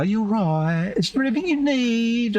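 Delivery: put-on high, sing-song talking to a child; patronising voice. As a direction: talk down like to a little kid. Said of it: "they lean over and they go".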